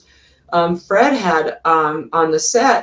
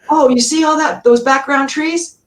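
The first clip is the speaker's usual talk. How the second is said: high, loud, eager pointing out; an excited director spotting free scenery; 9 semitones higher, 3 dB louder than usual. Retold or imitated. Director Fred to his crew, high and eager. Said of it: "And Fred goes".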